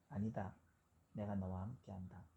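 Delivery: hushed tender assurance from God; God's inner voice. Retold or imitated. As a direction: speak like a gentle voice in the heart. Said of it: "그런 마음의 음성을 들려주시다".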